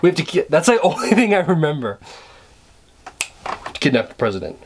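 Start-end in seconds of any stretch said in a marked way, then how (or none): 2.0-4.6 Cage line muttered. Pretend. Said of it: "Nicolas Cage impression".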